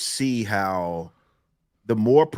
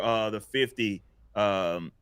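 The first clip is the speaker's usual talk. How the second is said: ordinary talk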